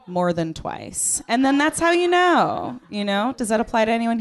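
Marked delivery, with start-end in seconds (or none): none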